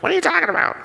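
very high, strained mock squawk; locked-up throat voice. Answering his wife about his voice, hammed-up strained squawk. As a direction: squeeze it out through a tight throat, mock-defensive. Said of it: "what's up with your voice".

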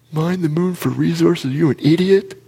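high, loud mocking jeer at a dumb idea; mock him like a teenage buddy, loud and high; mocking friend. Best friend pointing and laughing.

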